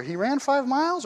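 "miles" lifted and weighted; he ran five MILES; stress on miles. Shown as a demonstration. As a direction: land on "miles". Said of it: "well, is it".

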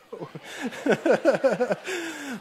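long, high chuckle; real laugh. Real, not acted. Preacher joking about his kids, long high laugh. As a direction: keep laughing through the joke. Laughs at own bike joke.

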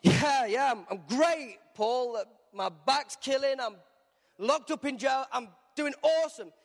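sarcastic cellmate pretending he's fine; sarcastic Silas. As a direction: fake brightness over a grumble. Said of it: "Silas is like".

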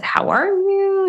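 high, slow and gentle; kindly rabbi asking the dying grandmother how she is, high and slow; rabbi's gentle question. Retold or imitated. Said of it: "he said".

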